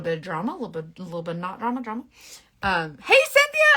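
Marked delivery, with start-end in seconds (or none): 3.0-3.7 high, loud call across the room. Real, not acted. Calling out to Cynthia across the house, loud. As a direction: shout a name to someone nearby.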